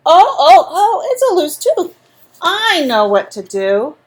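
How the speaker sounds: lightly voiced confident dentist; dentist's knowing oh. A narrator's reading. Dentist looking in Andrew's mouth, mock surprise then calm. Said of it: "and said".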